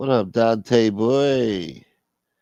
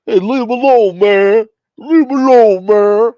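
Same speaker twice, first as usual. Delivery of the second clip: high, loud character yell; shouted protest; 10 semitones higher, 11 dB louder than usual. Acted. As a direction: yell at them to back off. Acting out a street argument.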